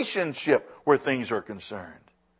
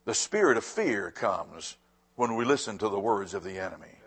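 much softer and lower, stress on spirit; soft repeat; 10 semitones lower, 9 dB quieter than usual. Shown as a demonstration. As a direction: drop to a hushed, weighty repeat. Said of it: "I'm gonna say that again".

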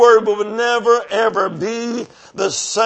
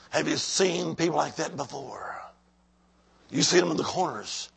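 breathy whisper, almost no voice; a confiding whisper to the church; hushed aside; 7 semitones lower, 13 dB quieter than usual. Real, not acted. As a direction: whisper the question to the room. Preacher's hushed aside to congregation.

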